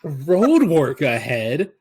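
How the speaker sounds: loud, drawn-out comic bit delivery; hammed-up stand-up comic cadence; John Mulaney. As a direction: read the sign, then answer with exaggerated swoops. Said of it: "Okay, John Mulaney".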